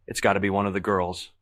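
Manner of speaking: usual speaking voice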